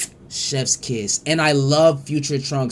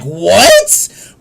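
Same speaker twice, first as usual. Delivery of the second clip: a loud, higher, rising "What?"; shocked "What?"; 6 semitones higher, 11 dB louder than usual. Retold or imitated. Kid watching Cell appear, yelling a shocked "What?". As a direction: blurt it out in disbelief. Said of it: "And you're like".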